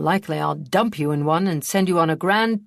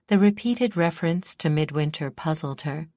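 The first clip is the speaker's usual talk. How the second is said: usual speaking voice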